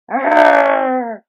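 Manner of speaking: very deep loud walrus roar; huge low growling bellow. Acted.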